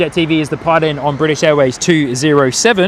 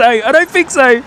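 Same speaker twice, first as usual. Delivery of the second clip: higher and louder, a cheeky outburst; playful exclaim; 9 semitones higher, 3 dB louder, slower than usual. Real, not acted. Streamer joking with viewers about the mandarin, excited. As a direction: bounce it up with glee.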